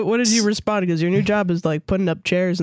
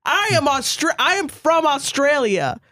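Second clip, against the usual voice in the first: hammed-up Aussie pickup line, raised pitch; mock Australian accent; 7 semitones higher than usual. Friend mimics the Aussie hitting on a girl, hammed-up accent. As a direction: put on a thick Australian accent to impress. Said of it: "He was really trying to be like".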